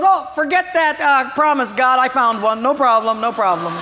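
high, loud, offhand brush-off; breezy dismissal. Acted. Driver who found a spot brushes off God, breezy and loud. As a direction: shrug the promise away cheerfully. Driver cancels his promise.

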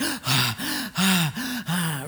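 big puffing breaths before a dive; imitated hyperventilation. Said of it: "we do a hyperventilation thing".

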